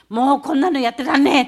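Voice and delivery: loud, rough complaint in dialect; grumbling student. Retold or imitated. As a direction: play a fed-up schoolboy shouting out. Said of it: "男子の生徒たちが言ってる声". (疲れた男子生徒の大声の愚痴)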